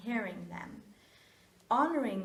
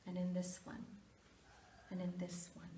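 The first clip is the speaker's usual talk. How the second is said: fading to a whisper; 2 semitones lower, 10 dB quieter, faster than usual. Acted. Repeating phrase, fading to whisper.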